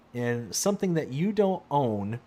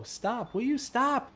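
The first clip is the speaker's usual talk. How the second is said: rising, high-pitched plea; exasperated pleading; 9 semitones higher, slower than usual. Real, not acted. Streamer begging the game to stop, exasperated. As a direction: plead upward, higher on the repeat.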